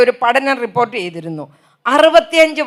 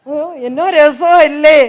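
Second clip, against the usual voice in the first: mock delight; 4 dB louder, slower than usual. Real, not acted. Mocking delight at funerals.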